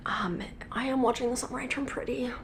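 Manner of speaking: sheepish whisper; whispered confession. Real, not acted. Admitting a guilty-pleasure show to viewers, sheepish whisper.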